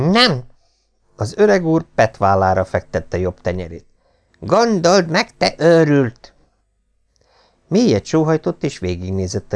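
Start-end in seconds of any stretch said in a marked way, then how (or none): 0.1-0.4 raised, lightly voiced stern father; stern old lord. A narrator's reading. Lord Travers lecturing his thieving son, higher stern voice. 4.4-6.3 higher, scolding stern father; exasperated old lord. A narrator's reading. Lord Travers scolding his son as a madman.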